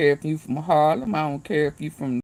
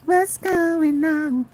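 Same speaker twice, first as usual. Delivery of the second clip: much higher, slow, drawn-out question; mock high voice; 11 semitones higher, much slower than usual. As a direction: stretch it out in a high put-on voice.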